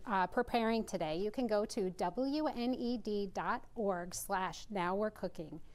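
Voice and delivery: normal talking voice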